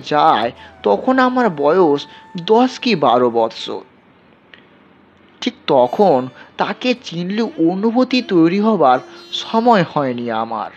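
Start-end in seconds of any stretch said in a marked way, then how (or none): none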